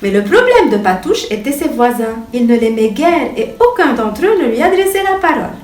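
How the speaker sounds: usual speaking voice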